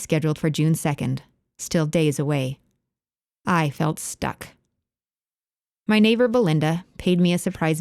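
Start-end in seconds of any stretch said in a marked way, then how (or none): none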